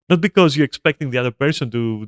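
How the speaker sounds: ordinary delivery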